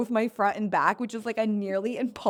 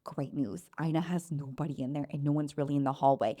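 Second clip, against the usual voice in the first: lower, softer cheerful nurse; lightly voiced nurse; 6 semitones lower, 6 dB quieter than usual. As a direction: play the nurse's breezy news, low and soft. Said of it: "She's like".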